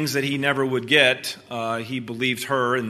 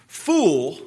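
higher snarling insult; 4 semitones higher, 5 dB louder than usual. A narrator's reading. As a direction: spit it out with a savage sneer. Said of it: "with a savage smile that was almost a snarl".

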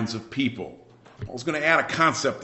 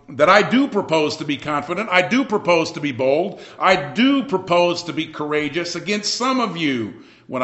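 louder, higher, with "do" hit each time; emphatic assurance; 5 semitones higher, 6 dB louder than usual. Acted. Paul warning the Corinthians he will be bold, emphatic "do". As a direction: lean hard on "do" in each repeated vow. Paul's firm warning, acted paraphrase.

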